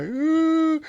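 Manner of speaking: put-on high hurt little-boy "Ooh"; childish whimper. Boy hurt by schoolyard taunts, high whimper. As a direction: whimper like a hurt five-year-old.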